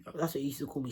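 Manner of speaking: ordinary delivery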